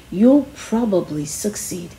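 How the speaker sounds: ordinary delivery, nothing marked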